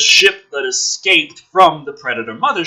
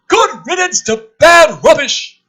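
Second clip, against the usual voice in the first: much higher, loud, slow gloating; pompous general; 9 dB louder, slower than usual. Acted. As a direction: boom it like a smug general. Hammy general line, loud high.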